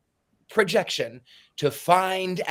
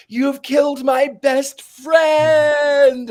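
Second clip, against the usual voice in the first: a slow, drawn-out cry, much higher and louder; anguished cry; 10 semitones higher, 8 dB louder, much slower than usual. Retold or imitated. Crowley cries out over his murdered friend, anguished. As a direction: wail it out as if your best friend were dead. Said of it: "he cries out".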